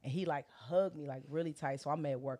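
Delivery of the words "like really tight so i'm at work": usual speaking voice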